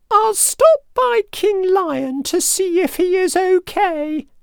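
high, squeaky mouse voice; little mouse. A narrator's reading. Mouse deciding to visit the lion, high squeaky voice. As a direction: think aloud as a tiny mouse. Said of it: "he thought".

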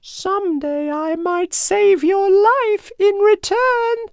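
slow, very high mouse voice; earnest plea in a tiny squeak; squeaking little mouse. A narrator's reading. Mouse offering to save the lion's life, squeaky plea. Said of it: "he squeaked on".

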